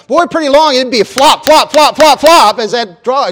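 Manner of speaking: loud, high flop flop sound effect; excited. Acted. Dog dragged by car, sound effect.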